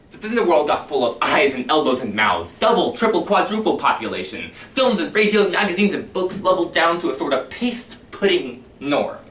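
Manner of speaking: a rising rant to the firemen about crowded world; building rant. Acted. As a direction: pile up the outrage. Beatty's rant swells.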